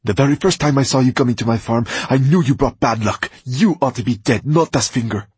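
snapping furiously. A narrator's reading. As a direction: spit the words at him. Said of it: "Roxanne snapped at him".

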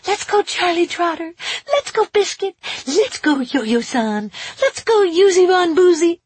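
loud, squeaky pet-nickname chant; high, excitable talking-to-the-dog voice; high-pitched dog voice. A narrator's reading. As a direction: egg the dog on in a silly high voice. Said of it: "in my high-pitched Yo-Yo voice".

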